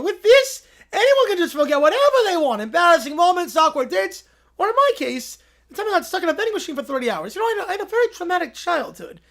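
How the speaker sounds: high, loud hammy mad scientist; Doof villain voice. Acted. Doof presenting his invention to Keller. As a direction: gloat theatrically like a cartoon villain. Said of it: "Here we go".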